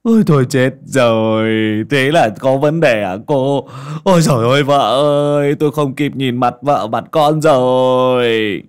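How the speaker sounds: loud, wailing near-sob, slowing at the end; a scared man bemoans his fate; whimpering lament. A narrator's reading. Uncle Sinh wails to Ly fearing death, whimpering. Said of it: "chú Sinh mếu máo".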